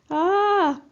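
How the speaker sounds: high, loud scared cry; shrieking rat in terror; frightened shout. Acted. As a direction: scream in panic at the shaking. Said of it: "shouts Rose".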